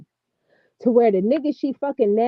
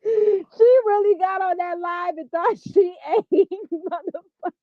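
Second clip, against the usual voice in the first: cracking up; 7 semitones higher than usual. Real, not acted. Laughing at the woman's live.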